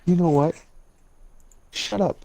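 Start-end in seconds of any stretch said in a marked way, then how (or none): none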